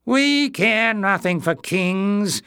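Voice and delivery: high, loud, slow sneering creature voice. A narrator's reading. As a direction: look down on the king and sneer. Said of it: "sneered the first Greyness".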